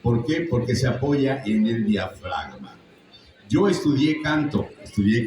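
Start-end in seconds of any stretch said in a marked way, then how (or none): none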